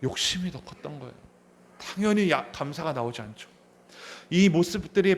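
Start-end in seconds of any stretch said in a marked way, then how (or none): none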